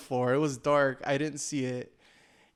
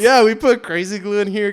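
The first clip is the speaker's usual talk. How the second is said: excited; 6 semitones higher, 12 dB louder than usual. Real, not acted. Excited telling of glue fix.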